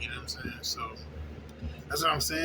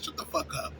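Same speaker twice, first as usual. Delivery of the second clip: whispered inner thought; 2 semitones lower, 7 dB quieter than usual. Retold or imitated.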